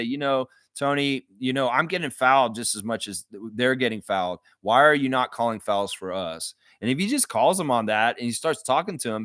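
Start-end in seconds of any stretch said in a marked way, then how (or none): none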